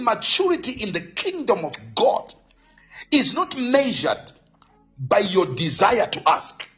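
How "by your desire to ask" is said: usual speaking voice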